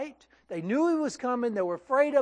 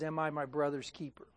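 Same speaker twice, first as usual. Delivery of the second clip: sarcastic; 6 semitones lower, 7 dB quieter than usual. Retold or imitated. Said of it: "in contempt and even sarcasm".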